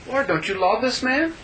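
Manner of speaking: high-pitched appeal to the Lord; pleading protest. Acted. Voicing a plea to the Lord.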